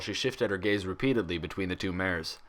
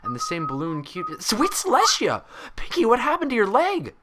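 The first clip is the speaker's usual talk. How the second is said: high, loud horrified cry; shocked shout; 5 dB louder than usual. Acted. Twilight shouting at Pinkie about her scarred leg, high and loud. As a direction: break off mid-thought into alarm. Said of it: "Twilight shouted".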